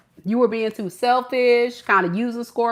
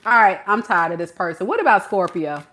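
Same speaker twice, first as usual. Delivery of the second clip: normal talking voice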